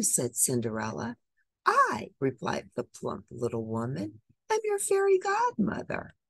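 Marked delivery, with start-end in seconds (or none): 1.6-2.1 fairy godmother. Acted. Fairy godmother introduces herself. 4.5-6.2 fairy godmother. Acted. Fairy godmother introduces herself.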